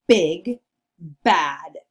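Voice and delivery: Big, bad with a loud, punched B; stress the B. Shown as a demonstration. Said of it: "Can you say that with me?".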